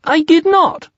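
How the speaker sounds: very high, loud little protest; indignant high-pitched squeak. A narrator's reading. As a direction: squeal the denial like a tiny piglet. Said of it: "squeaked Piglet".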